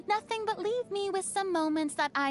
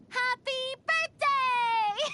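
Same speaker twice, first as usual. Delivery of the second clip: cheery birthday call; 5 semitones higher, 4 dB louder, slower than usual. Acted. Birthday greeting with gift.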